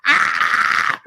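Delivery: a short, toneless, airy mock scream; imitating Jesse's scream. Retelling Jesse seeing his hand, a breathy mock scream. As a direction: rip out a breathy little horror-movie yelp. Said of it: "His scream, every time Jesse yells".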